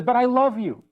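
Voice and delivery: mock pleading, pitched high; husband's protest. Husband cuts across his wife's complaint, protesting.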